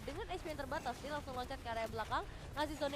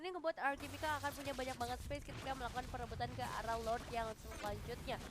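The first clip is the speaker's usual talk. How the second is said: normal talking voice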